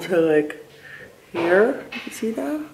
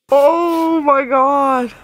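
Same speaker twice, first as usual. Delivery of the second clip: surprised; 7 semitones higher, 5 dB louder, much slower than usual. Real, not acted. Reacts to treatment mishap.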